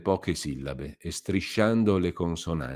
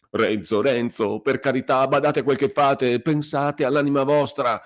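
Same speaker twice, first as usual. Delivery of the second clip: put-on higher, pleading old curate, begging him to stop; frightened old priest; 8 semitones higher, 5 dB louder than usual. A narrator's reading. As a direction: plead for mercy, scared. (il curato implora Renzo, supplichevole e impaurito)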